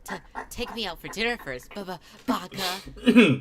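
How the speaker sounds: put-on a bashful anime girl who trips over her words; flustered anime stutter. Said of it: "And it was flustered".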